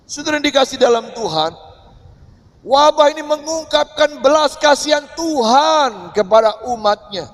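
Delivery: high, loud, impassioned sermon; fervent preaching. Acted. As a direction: preach it out boldly to the congregation. Preaching at full force. (khotbah lantang dan berapi-api)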